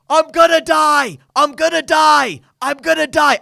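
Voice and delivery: loud, high mock scream of terror; screaming in panic. Podcaster imitating a panicking plane passenger, screaming. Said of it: "Screaming at the top of his lungs".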